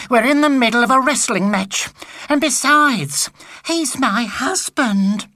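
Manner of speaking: a flustered wife pleading her case; exasperated wife. Acted. Wife voiced in story.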